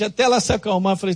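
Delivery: usual speaking voice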